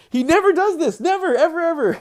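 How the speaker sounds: very high, loud protest; exasperated disbelief. Real, not acted. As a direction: insist in baffled disbelief. Baffled by dog's behaviour.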